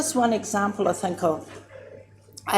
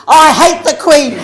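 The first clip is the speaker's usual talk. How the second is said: much higher and louder, laughing; loud laughing outburst; 9 semitones higher, 9 dB louder than usual. Retold or imitated. Auntie asked about the Queen apologising, loud and laughing. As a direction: blurt it out with a laugh.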